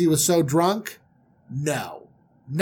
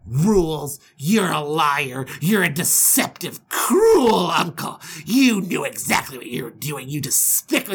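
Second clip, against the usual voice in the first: outraged accusation. Acted. Groom denouncing his deceitful uncle, outraged. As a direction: draw out each insult.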